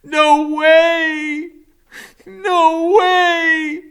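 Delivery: high, loud, tearful cry. Retold or imitated. Max hearing Kevin died, crying out high and loud. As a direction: wail it out in disbelief. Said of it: "I'm going".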